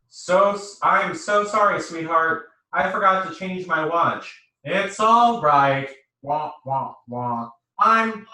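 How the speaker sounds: loud, excited calls over a bad line; shouting on the phone. Boyfriend shouting down a bad phone line at night, loud and happy. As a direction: shout it happily into a crackling phone. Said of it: "Joey was shouting".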